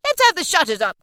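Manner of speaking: high, loud, festive orders; jovial cry. A narrator's reading. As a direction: call out a holiday to the lads. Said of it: "cried old Fezziwig".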